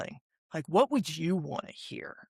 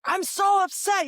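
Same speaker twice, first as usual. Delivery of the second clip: high, loud mock-upset outburst; acted yelling; 7 dB louder than usual. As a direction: yell it out as a demonstration of someone upset. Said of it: "like, when somebody's, like, yelling".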